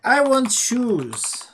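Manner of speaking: high, slow, loud princess voice; cartoon princess. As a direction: drawl it out like a spoiled princess. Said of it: "She's like".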